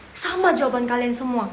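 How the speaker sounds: ordinary talk, nothing marked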